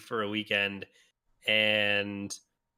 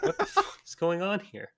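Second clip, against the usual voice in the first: higher, laughing through the words; laughing quote; 7 semitones higher than usual. Retold or imitated. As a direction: retell her mocking laugh mid-sentence. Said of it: "just starts laughing at me".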